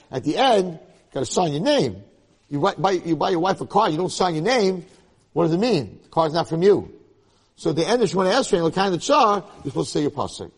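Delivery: loud, fervent preaching; rising sermon fire. Acted. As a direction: drive it home to the crowd. Preaching at full force.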